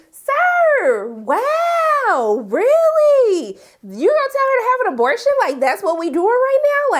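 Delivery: high, loud "Sir, wow, really?"; shocked calling-out, voice jumping up; indignant high-pitched reaction; outraged disbelief. Acted. Outraged at Lawrence on screen.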